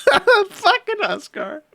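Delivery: high, slow, laughed-out words; wheezy amusement lifting the pitch; giggling repeat. Real, not acted. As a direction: savor the line through a giggle. Repeats favorite line, still laughing.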